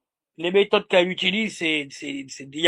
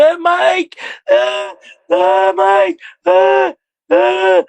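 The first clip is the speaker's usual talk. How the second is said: much higher, loud shrill mock yell; mock hysterical yelling; 8 semitones higher, 3 dB louder, much slower than usual. Streamer imitating the woman yelling at Mike, high mock shout. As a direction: scream like she's losing it. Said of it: "elle hurlait".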